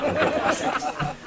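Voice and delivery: breathy high laughing aside. Real, not acted. Comic laughs to the crowd, giggling. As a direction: giggle the line out.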